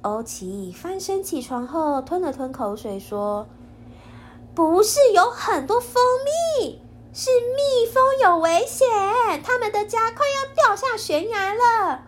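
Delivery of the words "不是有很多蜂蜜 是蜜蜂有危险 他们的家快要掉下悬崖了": high, loud urgent correction; urgent child voice. Acted. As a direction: insist the bees are in danger. Jisaiya urgently corrects Oki.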